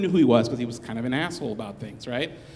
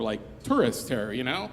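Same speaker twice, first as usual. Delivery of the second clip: ordinary talk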